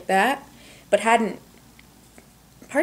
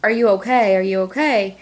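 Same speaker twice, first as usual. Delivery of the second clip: retold holler; 4 dB louder than usual. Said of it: "I holler in there".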